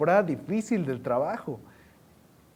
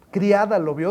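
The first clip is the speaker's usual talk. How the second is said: normal talking voice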